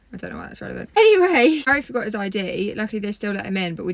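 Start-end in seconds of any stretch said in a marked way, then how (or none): 0.1-0.9 under-the-breath whispered aside. Real, not acted. Girlfriend whispering a side remark to viewers. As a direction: drop to a whisper for a throwaway comment. Aside about cousins' genders.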